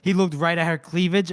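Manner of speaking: ordinary delivery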